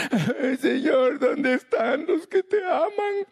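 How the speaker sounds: high, slow hammed-up wailing to the Lord; mock crying prayer. Pastor mocking a weeping prayer, high and slow. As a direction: fake sob out the lament, dragging it high. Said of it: "nunca me he puesto a llorar".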